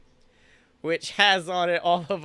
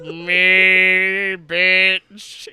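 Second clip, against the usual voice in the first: booming, much louder god voice echoing through the ocean; deep echoing sea voice; 12 dB louder, much slower than usual. Retold or imitated. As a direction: boom it like a god calling from the deep. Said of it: "a deep voice echoing throughout the ocean".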